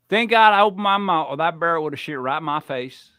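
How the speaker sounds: thick mock Southern twang; imitated Southern accent. Host quoting father-in-law's Southern friend, mock Southern accent. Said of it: "I heard this Southern guy".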